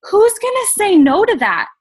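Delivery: high, loud rhetorical cheer; excited. Real, not acted. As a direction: throw it out triumphantly. Rhetorical punch to class.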